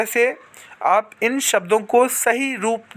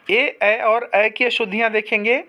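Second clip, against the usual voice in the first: ordinary talk, nothing marked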